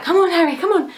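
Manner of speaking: high, loud call to the dog. Retold or imitated. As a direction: call Harry in from the garden. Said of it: "I was like".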